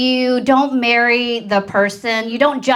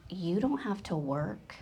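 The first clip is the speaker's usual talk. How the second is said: soft husband quote; 6 semitones lower, 17 dB quieter than usual. Retold or imitated. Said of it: "But he goes".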